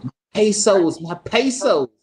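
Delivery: high, loud mock refrain; exaggerated high imitation; mimicked song hook. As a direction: belt out a silly hook, mockingly. Mimics the silly song lyric.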